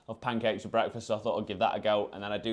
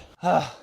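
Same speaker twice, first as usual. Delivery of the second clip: tired sigh; 7 semitones higher, 7 dB louder than usual. Real, not acted. Stiff body morning.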